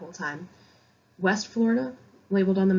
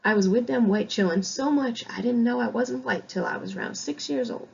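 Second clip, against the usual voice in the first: a light Southern accent, first half higher, ending dropped to a whisper; 4 semitones higher than usual. Shown as a demonstration. Teacher trying the novel's dialect aloud to students, light Southern accent. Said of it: "let's see if I can do this".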